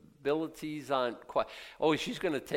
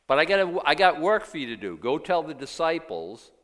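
loud, higher, eager charge; urgent command; 4 semitones higher, 8 dB louder than usual. Acted. Jesus telling Mary to go, urgent and loud. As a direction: call her to the task with urgency.

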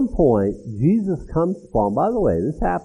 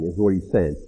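ordinary delivery

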